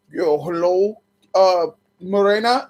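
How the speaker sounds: lightly disguised caller voice, drawn out; changed voice. Retold or imitated. Caller calling back in disguise, lightly disguised voice. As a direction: fake a different man calling back. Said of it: "change your voice".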